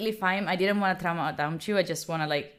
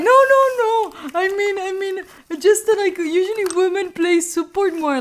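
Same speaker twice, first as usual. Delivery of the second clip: high, loud mock panic; hammed-up frantic excuse; flustered backpedalling; 9 semitones higher, 7 dB louder than usual. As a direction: blurt a panicked denial, pitched way up. Acted flustered backpedalling in retold chat.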